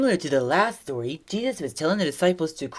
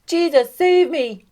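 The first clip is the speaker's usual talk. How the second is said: high, raised cry for help; yelling for rescue; 8 semitones higher, 6 dB louder, slower than usual. Acted. Sinking Peter calling to Jesus, yelled plea. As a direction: cry out urgently, higher and louder. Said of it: "he started to sink and yelled".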